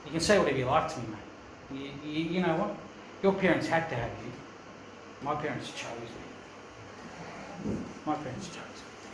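soft, dropping to a whisper; quiet kid's retort. Retold or imitated. Adopted kid answering bullies, fading to whisper. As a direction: let the last line fade into a whisper. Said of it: "the little kid turns up and goes".

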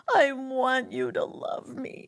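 lightly mocking a clingy lover; mock needy whine. Mocking needy people to the interviewer, in a light clingy whine. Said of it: "Wanty, needy".

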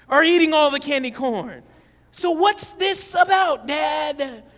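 put-on a kid gleefully busting her father; indignant daughter. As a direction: catch Dad out, loud and accusing. Daughter calls out father's hypocrisy.

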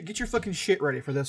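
ordinary delivery

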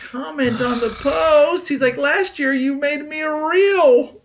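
high, slow, drawn-out complaint; mock indignation. Slighted friend complaining about a birthday post, mock indignation.

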